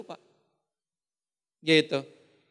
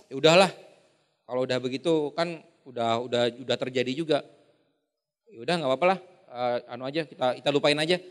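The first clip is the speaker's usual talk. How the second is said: lower, offhand brushing it aside; casual dismissive; 7 semitones lower, 3 dB quieter than usual. Retold or imitated. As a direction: shrug it off lightly. Sanguine person brushing it off. (santai meremehkan, nada lebih rendah)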